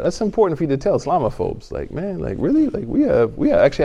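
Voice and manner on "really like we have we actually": sharp high really; a scoffing, incredulous really; mock disbelief. Mocking disbelief at Islamophobes.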